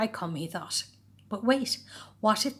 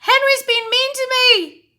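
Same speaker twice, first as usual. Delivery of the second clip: whiny telltale; 12 dB louder, slower than usual. A narrator's reading. Peter telling tales to mum.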